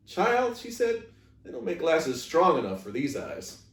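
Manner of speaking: lightly voiced wise old woman, gently amused; a hint of a kindly elderly neighbor; old woman Gloria. Gloria speaks to Opal.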